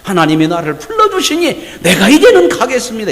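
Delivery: high, laughing eager acceptance; eager hypothetical Moses. Pretend. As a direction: play Moses thrilled at last. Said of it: "이렇게 나와야".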